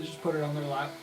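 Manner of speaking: ordinary delivery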